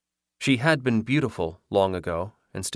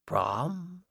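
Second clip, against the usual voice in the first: soft high call; 9 semitones higher, 7 dB quieter than usual. A narrator's reading. Ghost calls softly for Brom.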